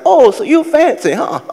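put-on cheeky old-timer quoting a rap line; old man voice. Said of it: "He like".

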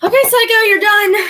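sharp loud call to the pet; loud scolding. Real, not acted. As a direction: tell the pet off loudly.

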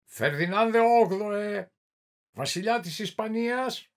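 high, loud royal summons; calling out. A narrator's reading. Inquisitor summoning the hidden King of Spain, calling out. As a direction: stretch the name out in a ringing call. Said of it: "Μετά φώναξε".

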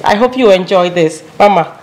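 ordinary talk, nothing marked

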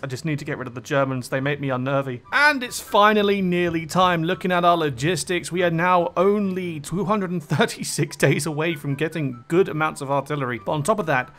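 ordinary talk

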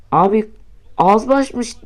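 ordinary delivery

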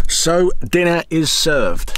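slow, grand waiter voice; waiter announcement. Pretend. Announcing meal.

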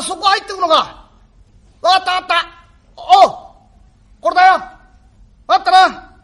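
high, loud shouted farewells; calling out. Acted. Father and son calling goodbye across the street, loud. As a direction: call across the street to him.

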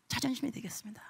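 soft unvoiced prayer whisper; whispered vow. Real, not acted. Believer vowing to God, hushed whisper.

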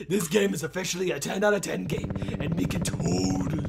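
ordinary talk, nothing marked

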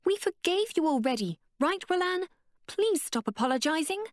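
high, pressing frustration; calm finally breaking; frustrated outburst. Acted. Abeka pleading with Connor, high frustrated. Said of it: "her usual calm breaking out of frustration".

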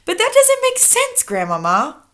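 put-on high, eager boy voice; a young boy objecting loudly; protesting child.

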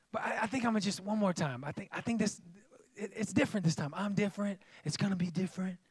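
mock timid rationalizing; sheepish excuse. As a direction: mumble the excuse like a pushover.

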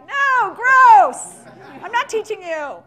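loud, high, laughing "no, gross!"; grossed-out refusal. Retold or imitated. Coach turning down men asking if it works on women, laughing disgust. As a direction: recoil in mock disgust and laugh it off. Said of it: "When I'm, I'm like".

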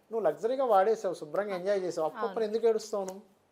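loud, high-pitched telling-off; mock scolding. Host lecturing someone who borrowed for luxury, loud scolding. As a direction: scold them loudly, voice lifted.

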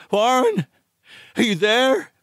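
high, anxious call, rising at the end. Acted. Narrator calling down to Warren again, anxious high call. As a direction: call out for an answer. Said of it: "Again I called down".

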